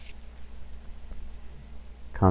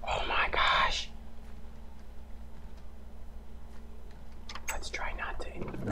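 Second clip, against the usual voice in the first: slow, breathless whisper; 21 dB quieter, slower than usual. Real, not acted. Gamer whispering to himself after nearly dying, shaken. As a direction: whisper it in shock. Hushed after near death.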